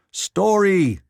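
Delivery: loud, high demand; crying out. A narrator's reading. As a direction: shout the single word at him. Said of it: "cried the editor".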